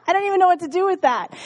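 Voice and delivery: quick, high laughing fluster; bewildered laugh over a surprise. Real, not acted.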